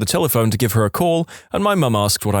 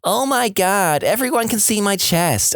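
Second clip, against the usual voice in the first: higher, slow mocking whine; mock crying; 9 semitones higher than usual. Mother mocking her upset daughter, hammed-up whine. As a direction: imitate a child's fake sobbing, lightly. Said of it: "fake crying in my voice".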